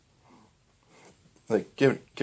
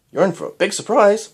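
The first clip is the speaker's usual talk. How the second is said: higher, louder playful tease; hint at the secret gleefully; mischievous Doctor voice; teasing; 9 semitones higher, 6 dB louder than usual. Acted.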